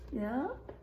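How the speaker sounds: normal talking voice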